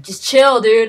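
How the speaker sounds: loud, high outburst in imitation; hammed-up loud urging; mock exasperated shout. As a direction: act out yelling at a panicking friend. Retold telling panicking companion to settle.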